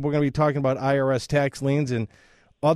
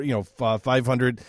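usual speaking voice

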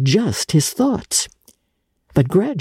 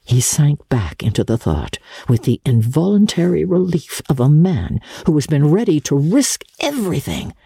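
ordinary delivery

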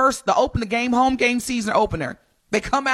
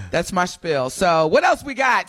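ordinary talk, nothing marked

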